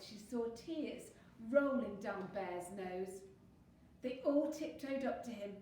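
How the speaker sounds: plain reading voice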